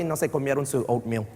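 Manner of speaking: ordinary talk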